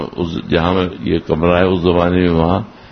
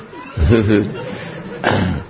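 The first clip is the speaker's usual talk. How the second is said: short amused chuckle; laughing; 3 semitones higher than usual. Real, not acted. Admitting to listeners he answered back, short chuckle. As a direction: chuckle briefly at your own story. Amused by his own retold confession.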